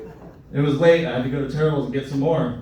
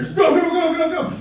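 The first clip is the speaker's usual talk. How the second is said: urgent go go go; 13 semitones higher, 4 dB louder than usual. Acted. Urgent rush to bathroom.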